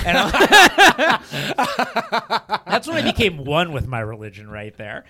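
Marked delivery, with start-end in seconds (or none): 0.4-2.6 big high cackle of laughter; cracking up hard; loud laugh. Real, not acted. Laughing at own story.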